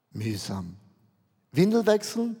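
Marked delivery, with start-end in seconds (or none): 0.1-0.7 low, much softer, glum and bored. Pretend. Preacher voices how listeners feel about everyday life, glum and soft. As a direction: sink the word like a weary sigh. Congregation's dull everyday named.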